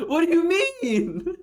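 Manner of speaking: high, slow, cracking with laughter; real incredulous laugh; laughing disbelief. Real, not acted. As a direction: laugh through the question.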